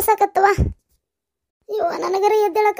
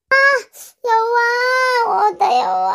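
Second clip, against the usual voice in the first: crying out in pain; 3 semitones higher, slower than usual. Acted. Old woman falls in kitchen.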